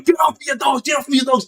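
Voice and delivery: high, strained yelling; mock yell. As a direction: fight them off with your voice. Inmate fighting guards off.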